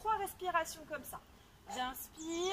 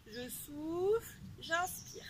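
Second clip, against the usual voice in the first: slow breath cue; slower than usual. Shown as a demonstration. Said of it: "coach paces the breathing".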